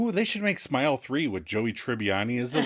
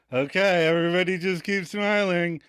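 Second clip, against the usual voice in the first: a hint of a strained grin over the words; forced smile; 6 semitones higher than usual. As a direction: keep smiling through it, mildly. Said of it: "[name], smiling too".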